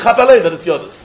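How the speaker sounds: normal talking voice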